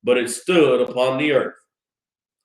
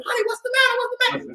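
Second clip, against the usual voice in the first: high, panicked calling out to a husband; a wife shrieking in alarm at her husband; frantic wife; 5 dB quieter, much faster than usual. Acted. Wives screaming at husbands.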